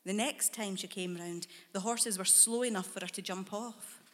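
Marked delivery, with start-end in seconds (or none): none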